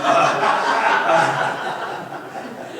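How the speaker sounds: long, breathy laugh that falls away; helpless laugh at his own story; burst of laughter. Real, not acted. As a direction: let the laugh roll out of you. Laughing while telling the story.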